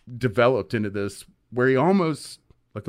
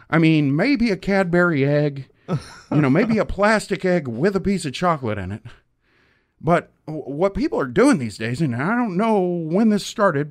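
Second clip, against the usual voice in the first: much higher, louder folksy rant; crazed caller; 7 semitones higher, 3 dB louder than usual. Retold or imitated. Patrol member ranting to radio host about Easter, high and loud. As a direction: play an indignant citizen-patrol guy ranting on air. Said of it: "And he's like".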